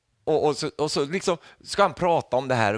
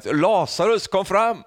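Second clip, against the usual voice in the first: loud, higher call with a drawn-out Lazarus; 9 semitones higher, 6 dB louder, slower than usual. Retold or imitated. Preacher voicing Jesus at the grave, loud call. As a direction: call him out of the grave with full force. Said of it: "Så ropar han ut Lazarus".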